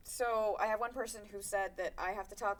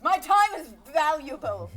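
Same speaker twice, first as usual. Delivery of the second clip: loud, raised-pitch joking declaration; mock proclamation; 7 semitones higher, 13 dB louder than usual. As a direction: announce it loudly for future employers, tongue in cheek. Joking plea to future employers.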